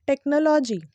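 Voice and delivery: "टेक्नोलॉजी" said as spelt, tek-no-LAW-jee; wrong pronunciation. Shown as a demonstration. Teacher shows pupils the spelling-based mistake, mispronounced "टेक्नोलॉजी" as tek-no-law-jee. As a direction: say "टेक्नोलॉजी" with full O vowels, "no-law". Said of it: "जो कि पूरा गलत है".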